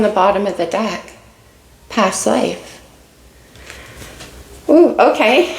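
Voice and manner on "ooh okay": high, loud startled ooh; surprised ooh. Real, not acted. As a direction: gasp of surprise at the card. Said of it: "my heart rate just went boof".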